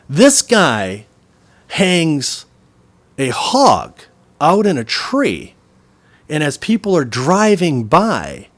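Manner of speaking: loud, slow, punched phrases with pauses; acted exasperated explaining. Butcher tells customer of his mistake, slow and emphatic. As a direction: spell it out as the put-out butcher.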